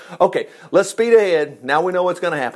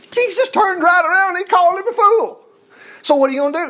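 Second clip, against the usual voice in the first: very high-pitched incredulous retort; incredulous exclamation; 12 semitones higher, 3 dB louder than usual. Real, not acted. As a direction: blurt it out high, as a gotcha. Gleefully rebuts the pulpit quote.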